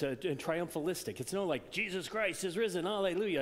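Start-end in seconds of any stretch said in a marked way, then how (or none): 1.7-3.4 louder, higher, hammed-up hallelujah; mock triumphant shout. Preacher mocking a triumphant Easter cry, loud. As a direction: proclaim it like a victory cheer. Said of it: "they're not triumphant".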